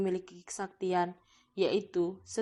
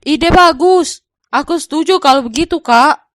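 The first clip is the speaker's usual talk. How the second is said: put-on higher, louder young man's voice; earnest Arya; 8 semitones higher, 21 dB louder than usual. Arya shows his wife the gold, higher and louder. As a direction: lift the pitch and project as the younger brother.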